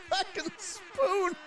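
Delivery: high laughing exclamation, drawn out. Real, not acted. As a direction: squeal the words through laughter. Laughs at Spoon mid-complaint.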